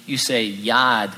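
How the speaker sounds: "yad" drawn out slowly with no r; Amity pronunciation. Retold or imitated. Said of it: "corrects his pronunciation of the word yard".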